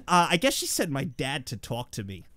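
normal talking voice

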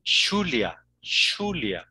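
"Julia" said as "SHU-lia"; hushed SH opening "Julia"; Rioplatense pronunciation. Shown as a demonstration. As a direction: demonstrate Argentine-style "Julia". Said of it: "los argentinos y los uruguayos dicen".

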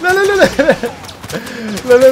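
high, loud panicked yelling; frantic high shouting; playful panic. Real, not acted. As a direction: yell it while fleeing under fire. Under fire, running away in game.

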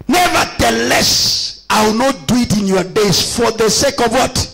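fervent sermon delivery over music; preaching. Acted. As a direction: preach it out to the congregation. Preacher reading, exhorting.

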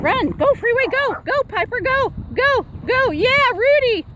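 high, eager calls to run; excited cheering. Real, not acted. Owner cheers her dogs on to run. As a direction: egg the dogs on to chase.

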